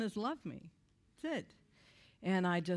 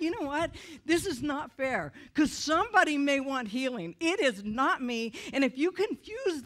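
loud, raised furious protest; angry inner rant; 5 semitones higher, 6 dB louder than usual. Retold or imitated. Complaining to God about unwanted healing, shouting. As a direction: rail against the unfairness. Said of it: "And I was so angry".

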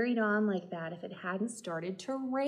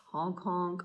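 ordinary delivery, nothing marked

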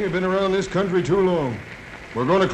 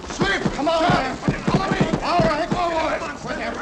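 higher, urgent battle calls; leading the charge; 6 semitones higher, slower than usual. Acted. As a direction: urge the men forward in the fight. Sheriff leads the charge.

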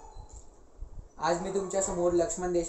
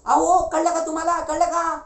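high, loud shout of news; urgent, raised-pitch yelling; excited hollering; 12 semitones higher, 11 dB louder than usual. Acted. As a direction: shout it out to the whole house. Boy hollers news to the household.

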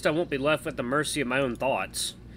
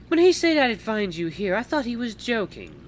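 higher-pitched character voice, amused; female visitor, teasing; 11 semitones higher, 5 dB louder than usual. Acted. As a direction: speak up high as a teasing woman.

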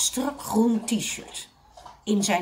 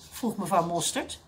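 ordinary delivery, nothing marked

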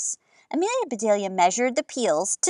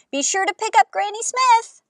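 higher call across the yard; 11 semitones higher than usual. A narrator's reading. Grandma calls out to the car. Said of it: "Grandma called out".

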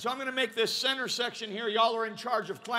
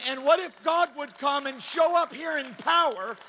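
high, loud revival-style preaching; impassioned preaching; 5 semitones higher, 6 dB louder than usual. Real, not acted. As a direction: preach it at full force, punching key words. Preaching at full force.

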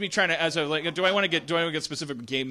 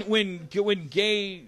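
ordinary talk

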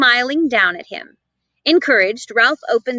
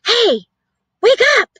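higher, louder urgent wake-up; urgent call; 12 semitones higher, 5 dB louder than usual. A narrator's reading. Boy urging the mouse to wake, urgent call. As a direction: try to rouse him. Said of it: "whispered the boy".

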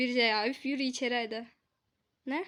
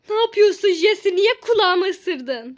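scolding; 5 semitones higher, 13 dB louder, slower than usual. Pretend. Scolding the dog.

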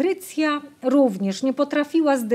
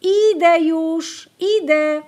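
high, loud and slow call back from far away; 7 semitones higher, 5 dB louder, slower than usual. A narrator's reading. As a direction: answer proudly from the hiding place. Girl answering from the field.

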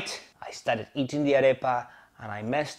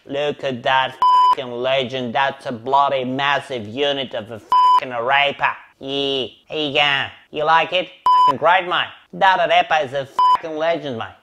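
mock Australian accent, matey swagger; Aussie bloke; 4 dB louder than usual. Cooking for Melbourne mates, mock Australian accent. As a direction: ham up a broad Australian accent. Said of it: "The Aussie arepa".

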